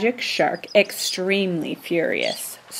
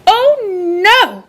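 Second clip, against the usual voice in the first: high, loud alarmed little pigs; frightened cry; 12 semitones higher, 6 dB louder than usual. A narrator's reading. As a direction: yelp it out in fright, much higher. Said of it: "cried the little pigs".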